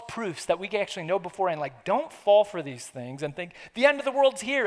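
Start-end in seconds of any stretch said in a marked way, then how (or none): 3.7-4.7 panicky alarm. Retold or imitated. Voicing panicked false belief.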